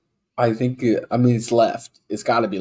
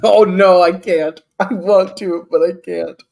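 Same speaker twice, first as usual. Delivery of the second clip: high, very loud role-played distress; loud, hammed-up anguish; anguished protest; 8 semitones higher, 13 dB louder, slower than usual. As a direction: wail that you cannot go through with it. Player agonizes over stabbing friend.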